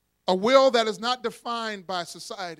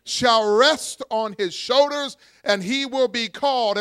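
preaching scripture aloud; 2 semitones higher, 5 dB louder than usual. Acted. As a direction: lead the congregation through the verse. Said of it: "everybody say government".

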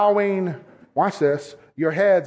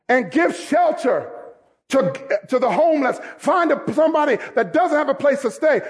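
preaching at full force; 7 semitones higher than usual. Acted.

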